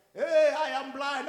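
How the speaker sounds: loud, high, slow play-shout; playing child. Child at home playing blind, mock shout imitated by parent. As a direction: call out like a child pretending.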